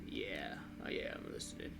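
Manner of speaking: very low, whispered acknowledgement; half-hearted murmur. Acted. Driver caught not listening by the boss, half-hearted murmur. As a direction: answer under the breath, barely voiced.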